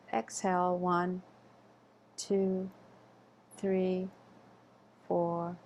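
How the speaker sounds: slow, evenly spaced counting with long pauses; guided breath count. Real, not acted. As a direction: pace a breathing exercise, one drawn-out number at a time.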